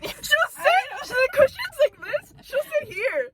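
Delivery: high, bright imitation of her friend perching; mock friend voice. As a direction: play her friend perched on the edge, hammed up. Acting out friend's posture.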